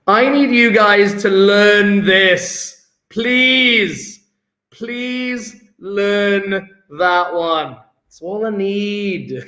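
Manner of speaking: slow, drawn-out plea; insistent, stretched-out begging; exasperated pleading. Real, not acted. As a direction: beg the class, stretching every word. Begging the class to learn it.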